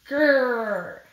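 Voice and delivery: a small put-on tiger growl; mock growl. Mouse growling at the snake to sound fierce. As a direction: growl to prove you're fierce.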